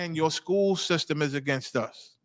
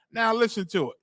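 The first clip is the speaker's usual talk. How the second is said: usual speaking voice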